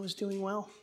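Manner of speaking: ordinary delivery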